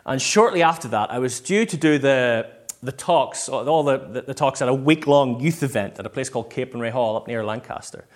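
ordinary talk, nothing marked

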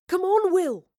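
high, loud urgent call; boy calling Will to the stage. A narrator's reading. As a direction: hurry him up with a shout.

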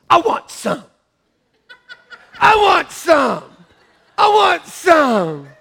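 put-on loud, high toddler tantrum, growing; demanding little kid. Little kid in a crib demanding what siblings got, mock tantrum. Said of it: "I would go like this".

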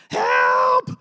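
high, loud mock cry for help; panicked cry. A man in trouble crying out to God, loud and high. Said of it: "And it's usually".

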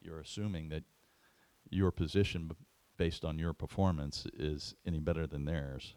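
normal talking voice

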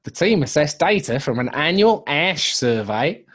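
hammed-up Antipodean newsreader, meant as New Zealand; New Zealand accent. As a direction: read the news in a shaky attempt at a New Zealand accent. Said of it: "the other half was New Zealand".

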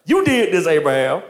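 mock accusation. Sarah blaming Abraham for her grief, high mock accusation.

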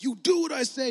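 a hint of a tyrant giving orders; dictator. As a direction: bark it like a petty dictator. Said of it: "this dictator thing like".

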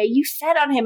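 FED hit hardest, falling after; stress on fed. Retold or imitated. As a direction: repeat it, now leaning on fed. Stefan repeats accusation.

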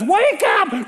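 high urgent wake-up call; mock yell. Disciples yelling at sleeping Jesus, mild high call. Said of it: "they're screaming at Jesus".

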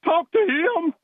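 a high, put-on old coach voice giving an order; imitating the coach. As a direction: mimic the coach calling his assistant over. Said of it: "and eleven says".

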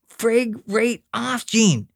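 slow, dragged out, fed-up; big brother finally snapping at Gene; slow exasperated. Acted. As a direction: spell it out word by word, exasperated. Pat loses patience with brother.

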